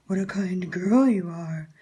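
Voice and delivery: slow, sly wolf compliment; smiling wolf. Acted. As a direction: flatter her with a toothy smile. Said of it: "smiled the wolf".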